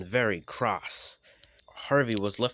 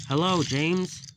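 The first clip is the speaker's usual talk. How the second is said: cheerful; 9 semitones higher, 6 dB louder than usual. Acted. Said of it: "he said cheerfully".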